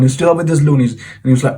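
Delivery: normal talking voice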